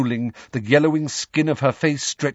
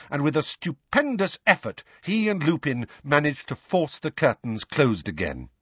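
ordinary delivery, nothing marked

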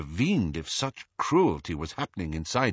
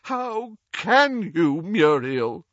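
high, slow, anguished groan; drawn-out groan, raised in pitch and volume; groaning protest; 8 semitones higher, 5 dB louder, slower than usual. A narrator's reading. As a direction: moan it out in appalled protest. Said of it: "groaned Doge".

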